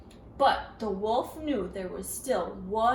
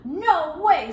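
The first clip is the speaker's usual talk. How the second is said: defiant pig; 4 semitones higher, 10 dB louder than usual. A narrator's reading. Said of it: "said Perry".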